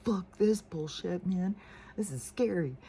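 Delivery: lightly hammed-up nervous dog; scared dog's voice. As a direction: speak for a dog who wants out. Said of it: "He's trying to stay by me".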